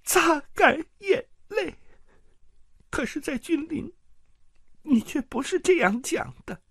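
high tearful woman's voice, bitter and accusing; a wronged wife spilling every secret through tears; tearful jealous aunt. Acted. Jealous aunt confessing her crimes to Petyr in tears. Lysa's long jealous tirade.

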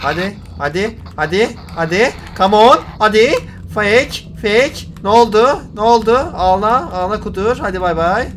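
loud, high, rapid-fire jeers; hyped taunting. Real, not acted. Gamer goading the chaser while faking it out, taunting.

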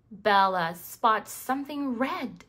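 plain reading voice

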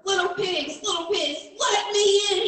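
high, loud mock snarl at the door; a storybook wolf snarling to get in. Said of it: "he snarled".

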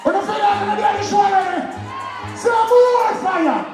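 shouted stage hype; hyped crowd warm up. Acted. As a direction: whip up the crowd. Stage hype over music.

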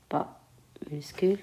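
pas minuscule chuchoté sans voix, en écrivant. Shown as a demonstration. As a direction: chuchote « pas minuscule » tout bas pour soi. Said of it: "Montre comment on écrit". (en écrivant au tableau, elle chuchote « pas minuscule »)

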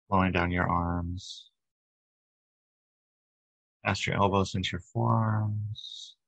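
even, unhurried meditation voice with long pauses; guided meditation. Acted. Leader guides a group to relax body and breath, guided meditation. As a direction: guide them gently through each breath, leaving space between phrases.

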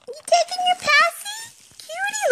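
very high cooing pet voice. Real, not acted. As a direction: coo up high at the puppy. Baby talk to the dog.

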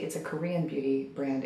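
hushed late-night voice; quiet voice. Real, not acted. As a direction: say it softly so nobody wakes. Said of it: "Everyone is asleep".

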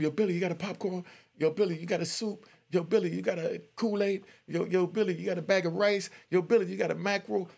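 pestering inmates. Retold or imitated. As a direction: call out to Billy like a needy inmate. Inmates calling out requests to Billy.

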